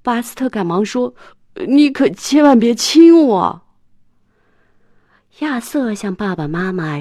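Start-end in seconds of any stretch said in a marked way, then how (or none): 1.7-3.6 high, much louder panicked protest; alarmed protest. Acted. Buster begging Dora not to kiss him, loud protest.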